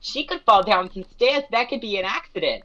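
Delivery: high-pitched imitation of a scheming friend; adorable little roommate. Little roommate suggests an accident, high and innocent. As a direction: pitch it up, sweetly suggesting mischief. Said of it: "this adorable little roommate".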